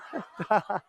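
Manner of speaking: short laugh. Real, not acted. Laughing at the memory.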